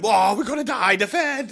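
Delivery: high, loud hammed-up wail; mock panic. Trader mocking doomsayers about the Fed, mock panic.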